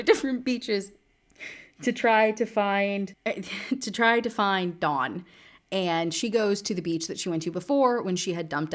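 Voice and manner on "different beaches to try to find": slow, higher, laughing through words. Real, not acted. Reader recapping the search, slow laughing delivery. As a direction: giggle through the line.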